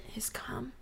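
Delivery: hushed whisper; whispering on the phone. Real, not acted. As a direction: whisper it like a secret. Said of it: "I feel like I have to whisper".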